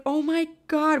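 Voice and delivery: high, excited oh my God; sister's excited reaction. Retold or imitated. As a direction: squeal it like the happy sister. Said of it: "she's like".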